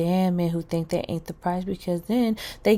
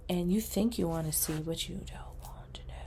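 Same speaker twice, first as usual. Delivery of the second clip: much softer, whispered; hushed warning; 8 dB quieter than usual. Real, not acted. Whispering to viewers like a seer's warning. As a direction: hush it like a secret.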